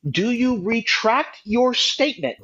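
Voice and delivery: ordinary delivery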